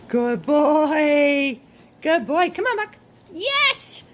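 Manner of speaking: loud, rising high praise, building; enthusiastic praise. Real, not acted. Trainer praising the dog, climbing excited good boys.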